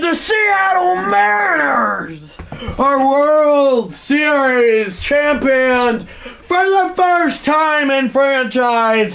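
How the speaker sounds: high shouted announcer call, stretched on Seattle; title call. Acted. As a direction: crown the champions as the broadcaster.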